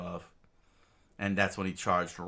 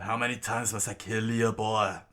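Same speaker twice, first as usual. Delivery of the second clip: screamed out; 3 semitones higher, 5 dB louder than usual. Acted. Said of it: "Crocodile screamed out".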